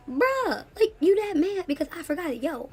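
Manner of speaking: can't believe the driver cursed over this; outraged disbelief. Retold or imitated. Disbelief at taxi driver cursing.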